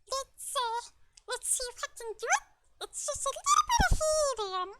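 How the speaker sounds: high helium chipmunk voice. Shown as a demonstration. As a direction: squeak like Alvin. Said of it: "a chipmunk-like voice".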